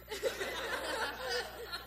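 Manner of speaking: short high laugh at his own joke; laughing at himself. Real, not acted. Preacher laughing at his own lion voice to the congregation.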